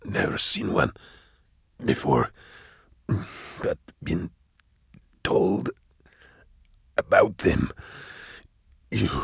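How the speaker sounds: low, soft, slow and halting; ragged dying voice. A narrator's reading. Dying hunter confessing to Drusha, ragged and weak. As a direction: labor out the words with long gaps. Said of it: "his breathing was ragged".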